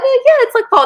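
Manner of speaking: very high-pitched mock New York accent; exaggerated imitation of a Brooklyn local. New York couple confirming the season to the hosts, high imitated accent. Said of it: "they talk like this all the time".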